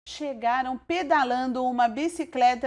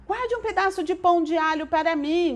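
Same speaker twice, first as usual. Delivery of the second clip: shouted; 6 semitones higher, 3 dB louder than usual. A narrator's reading. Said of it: "gritou um recém-chegado".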